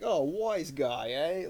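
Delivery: put-on drawn-out old New York accent, loud; exaggerated old-time New York wise-guy accent; Three Stooges. Showing a friend how the Stooges talked, mock old New York accent. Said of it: "the way the Three Stooges would talk".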